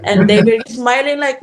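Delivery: ordinary talk, nothing marked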